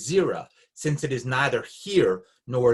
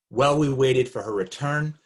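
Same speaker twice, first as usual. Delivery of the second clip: ordinary talk, nothing marked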